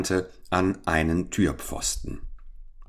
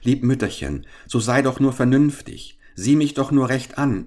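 higher, earnest appeal to a mother; urgent storybook child's entreaty; pleading; 6 semitones higher than usual. A narrator's reading. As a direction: plead to be recognised. Son pleads with mother to recognise him.

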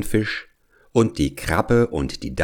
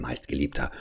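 soft, low endearment; tender aside; 6 semitones lower, 10 dB quieter, faster than usual. Acted. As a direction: murmur it to a loved child.

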